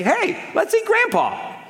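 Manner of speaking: high, silly sing-song twist on "Grandpa"; odd inflection. Shown as a demonstration. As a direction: squeak "let's eat" up high so "Grandpa" sounds like the meal. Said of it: "Same words, different inflections".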